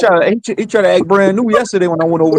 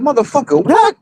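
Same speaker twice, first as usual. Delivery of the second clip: incredulous retort; 7 semitones higher than usual. Retold or imitated. Retold disbelieving retort.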